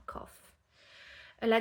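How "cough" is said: "cough" ending in an F sound. Shown as a demonstration. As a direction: say "cough" as kof. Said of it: "ça se prononce F à la fin".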